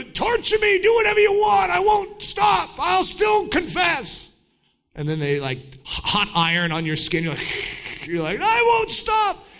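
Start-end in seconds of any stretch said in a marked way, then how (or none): 0.0-4.3 high, loud defiant cry; defiant martyr shout. Retold or imitated. Martyr shouting back at his torturers, defiant. 8.4-9.4 high, loud hammed-up refusal; mock defiant shout. Martyr with hot iron on skin, mock defiant shout.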